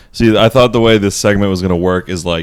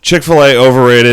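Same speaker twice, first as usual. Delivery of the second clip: bold verdict; 5 semitones higher, 9 dB louder than usual. Real, not acted. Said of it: "bold verdict after build-up".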